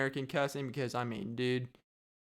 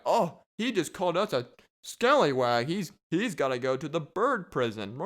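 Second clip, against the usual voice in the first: louder mock-appalled voice; appalled park staff; 2 semitones higher, 4 dB louder than usual. Park people reporting a rude parrot, hammed-up shock. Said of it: "And then they're like".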